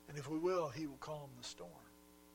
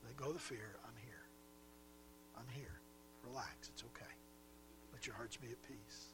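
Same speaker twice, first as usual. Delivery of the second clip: soft, hushed, comforting words; calm frightened friends tenderly; gentle reassurance; 4 semitones lower, 9 dB quieter than usual. Acted. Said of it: "He just says".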